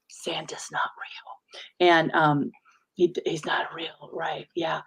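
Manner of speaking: soft, slow whisper; hushed secret. Pretend. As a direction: breathe it like a secret kids mustn't hear. Confiding the Santa secret.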